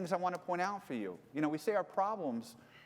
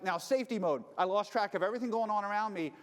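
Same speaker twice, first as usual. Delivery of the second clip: ordinary talk, nothing marked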